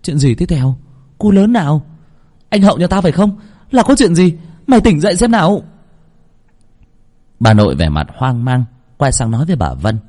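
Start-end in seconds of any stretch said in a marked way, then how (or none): 0.0-5.6 high, louder startled questions; astonished. A narrator's reading. Girl shocked by the boy's mumbling, astonished. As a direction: demand answers in shock. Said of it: "cô bé kinh ngạc".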